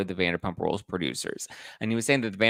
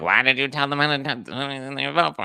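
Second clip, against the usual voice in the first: lightly voiced annoyed chatter; complaining viewer; 5 semitones higher than usual. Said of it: "It's like".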